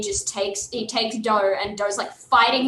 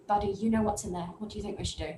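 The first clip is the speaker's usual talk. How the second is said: put-on hushed, much softer, to a child; soft consulting tone; 10 dB quieter, faster than usual. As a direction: ask a little one quietly. Aran quietly consults young Willen.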